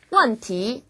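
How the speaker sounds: 问 drops, 题 climbs smoothly; fourth-second tones. Shown as a demonstration. Teacher models tones for learners, 问 falling, 题 rising. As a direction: fall on 问, then draw 题 upward. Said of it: "이렇게 쭉 올라가는 발음".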